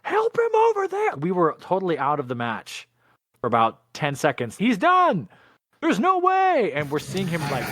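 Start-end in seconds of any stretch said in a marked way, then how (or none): 0.0-1.1 very high, slow mock yell; high-pitched retold screaming, drawn out; imitated crowd screaming. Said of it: "we're all yelling and screaming". 4.6-6.7 high-pitched alarmed mock shout; retold yell, pitched way up; imitated crowd yelling. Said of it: "we're all yelling and screaming".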